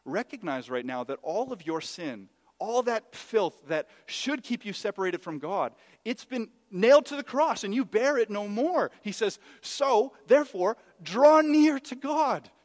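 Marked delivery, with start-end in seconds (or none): 2.6-12.5 loud, high preaching, building to a shout; impassioned preaching. Acted. Preacher urging the congregation to draw near to God, impassioned. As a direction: drive the call home at full force.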